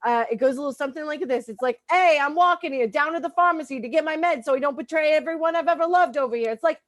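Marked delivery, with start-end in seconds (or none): 1.9-6.5 loud, higher New Yorker "hey, I'm walking here" voice; brash New York street accent, pitched up. Acted. Comic explaining her bipolar in a New York accent. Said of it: "I have to do an accent now".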